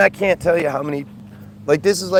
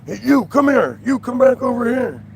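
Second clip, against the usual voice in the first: higher, louder cop bark across the park; a hammed-up cop yelling at walkers; cop's shouted call; 9 semitones higher, 4 dB louder than usual. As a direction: call them back like a cop on patrol. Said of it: "they flash the lights and they go".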